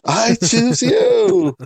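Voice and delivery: high, stretched-out anime battle cry; Pokemon trainer call. Pretend. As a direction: summon your Pokemon, slow and high. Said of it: "You sound like a Pokemon".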